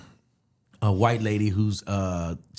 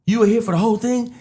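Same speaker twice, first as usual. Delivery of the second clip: shocked; 13 semitones higher, 6 dB louder than usual. Retold or imitated. Surprised girlfriend is there.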